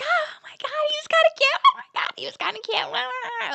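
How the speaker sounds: very high squealing mock gush; loud gushing. Drunk friends back from meeting the officer, loud gushing. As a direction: squeal it like rowdy, giddy friends. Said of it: "Like, loud again".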